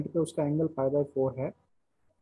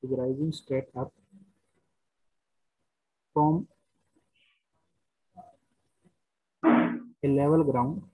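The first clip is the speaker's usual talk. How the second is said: very slow, word by word, repeating phrases; slow dictation; much slower than usual. Shown as a demonstration. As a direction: dictate slowly so students can write. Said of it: "question लिखिए पहले यहाँ पे".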